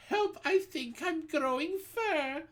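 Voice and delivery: high panicked cry for help; boy screaming in panic; boy's scream. A narrator's reading. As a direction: scream it as fur sprouts.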